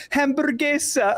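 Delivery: high, slow Spanish "Hamburguesa"; Spanish accent. Retold or imitated. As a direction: call out Hamburguesa like a Spanish clubgoer. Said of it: "I can hear people in Spanish go".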